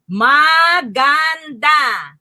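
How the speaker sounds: "Maganda" very slow as ma, gan, da, each drawn out; broken into syllables. Shown as a demonstration. Teacher having learners guess the word, "Maganda" broken up. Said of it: "break words and have learners guess".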